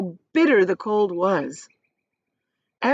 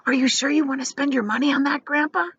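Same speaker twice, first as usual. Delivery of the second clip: put-on higher, quick boy's voice, only lightly hushed; a doubtful young boy asking; Charlie whispering; 7 semitones higher, faster than usual. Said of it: "Charlie whispered".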